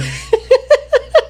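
squeaky, high-pitched laugh; giggling up high; high giggle. Real, not acted. Laughs at the joke.

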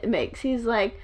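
ordinary delivery

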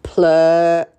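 sea's voice stretched long; drawn-out magic word. Acted. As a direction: call it out like the sea. Sea's message.

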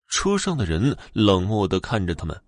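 ordinary delivery

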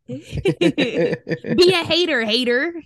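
laughing tease. Real, not acted. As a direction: giggle it out as a playful jab. Teasing mom while laughing.

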